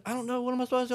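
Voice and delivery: high, whiny lost friend; high helpless voice. Retold or imitated. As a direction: plead like you're lost. Drunk friend voiced on phone.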